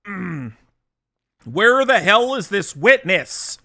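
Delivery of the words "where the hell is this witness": loud sharp voice. Acted. Judge calls for witness.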